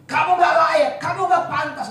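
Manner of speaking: loud, high shouted accusation; acted-out devil condemning someone; accusing devil. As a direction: hurl blame like the accuser. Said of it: "Iblis menuduh".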